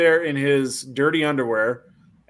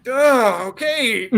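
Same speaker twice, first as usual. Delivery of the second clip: higher, groaning consent; mock reluctance; 8 semitones higher, much slower than usual. Reluctant kicker agreeing to try again, raised groaning voice. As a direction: give in with an acted groan.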